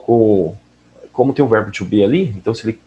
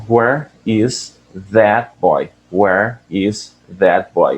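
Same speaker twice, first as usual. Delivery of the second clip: slow repeat; much slower than usual. Shown as a demonstration. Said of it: "repeating audio line slowly".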